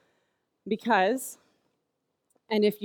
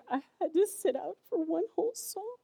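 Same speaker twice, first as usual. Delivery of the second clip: heartbroken son; 8 semitones higher, 5 dB quieter than usual. Retold or imitated. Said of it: "And he said".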